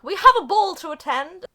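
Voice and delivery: loud, high, hammed-up announcement; mock proclamation. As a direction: declare it grandly to everyone. Acted group announcement.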